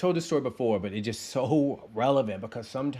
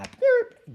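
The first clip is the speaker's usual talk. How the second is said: a quick imitated car horn; mock horn beep; 9 dB louder than usual. Driver mimics his own horn, a loud mock beep. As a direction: blurt out the horn noise like the car.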